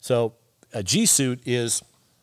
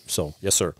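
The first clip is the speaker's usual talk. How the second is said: ordinary talk, nothing marked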